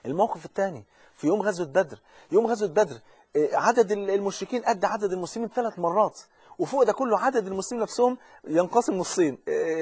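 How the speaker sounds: normal talking voice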